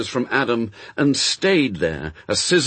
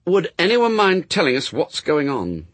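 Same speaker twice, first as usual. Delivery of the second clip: high, mildly stern demand; 7 semitones higher than usual. A narrator's reading. Anathema demands an explanation from the others, sternly. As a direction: ask it sternly, pitched up. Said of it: "said Anathema sternly".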